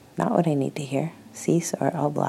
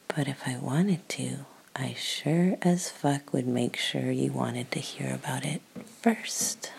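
soft breathy intimate whisper; ASMR whisper; 7 dB quieter than usual. Real, not acted. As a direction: whisper it close to the mic. Holds a whisper.

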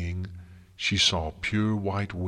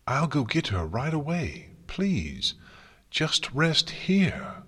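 higher, urgent but gentle appeal; anxious pleading; 7 semitones higher, slower than usual. A narrator's reading. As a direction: coax her to stay put, stretching the last words. Rarity pleads with princess to rest.

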